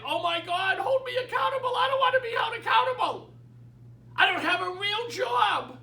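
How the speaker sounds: high, loud mock protest; whining realtors. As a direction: imitate panicky realtors wailing in complaint. Mimics realtors protesting accountability.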